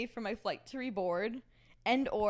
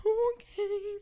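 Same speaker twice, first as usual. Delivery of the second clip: high meek okay; 10 semitones higher, 3 dB louder than usual. Retold or imitated. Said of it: "I said".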